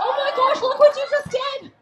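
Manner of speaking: high, loud, hammed-up dismay; scolding shout. Show host to the lumberjack up the tree, mock-scolding shout. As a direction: yell it up at the culprit.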